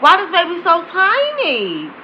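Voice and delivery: slow, drawn-out mock disbelief; imitating an amazed reaction, words dragged out; shocked onlooker. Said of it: "you just like".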